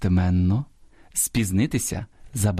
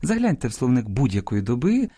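usual speaking voice

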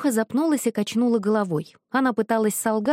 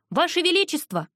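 calling out high; loud call; 8 semitones higher than usual. A narrator's reading. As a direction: call across the dungeon. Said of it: "Громкий оклик разнёсся".

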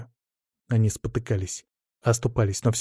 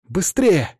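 urgent shout, higher; 7 semitones higher than usual. A narrator's reading. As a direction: yell it, hurrying him. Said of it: "кричал Артур".

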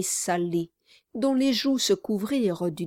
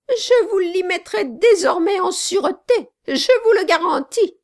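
high, loud, venomous promise; spiteful threat; 11 semitones higher, 8 dB louder than usual. Acted. Sally vows to lock up the servant, high and furious. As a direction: hurl the threat out, much higher and louder.

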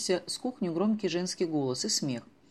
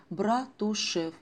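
slow, drawn-out Братушев; Братушев spoken very slowly and dreamily; savoring the name; 3 semitones higher, much slower than usual. Acted. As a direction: draw out Братушев slowly, tasting each syllable. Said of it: "вы только вслушайтесь".

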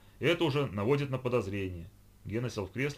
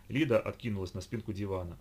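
plain reading voice

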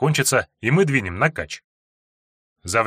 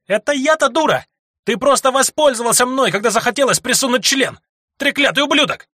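яростный женский крик; 13 semitones higher, 4 dB louder than usual. Acted. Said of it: "на разъярившуюся девицу".

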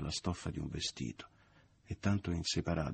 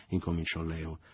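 ordinary delivery, nothing marked

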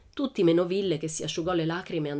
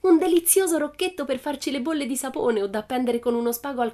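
high, delighted enthusing; triumphant; 6 semitones higher, 4 dB louder than usual. A narrator's reading. Pippi planning what to make with her spool, triumphant. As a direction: gush over the find in a raised voice. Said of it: "la quale trionfante sventolava".

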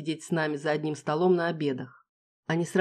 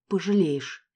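menacing threat; slower than usual. Acted. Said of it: "пригрозила, сверкнув клыками".

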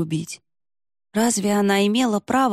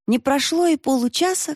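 usual speaking voice

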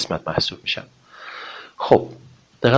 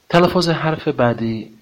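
usual speaking voice

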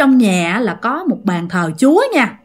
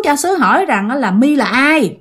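usual speaking voice

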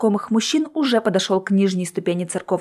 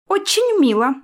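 sarcastic; 9 semitones higher than usual. A narrator's reading. Sarcastic retort.

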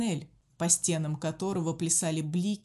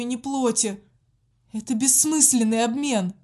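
loud, frantic begging; desperate pleading; 4 semitones higher, 7 dB louder than usual. A narrator's reading. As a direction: plead in terror. Prisoner begging in panic.

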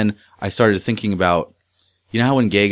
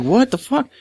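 shocked; slower than usual. Retold or imitated. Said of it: "I was like".

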